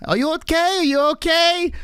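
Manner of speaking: put-on high, worried little-kid voice; imitating little daughter. Daughter calling up to her fallen dad, high worried child voice.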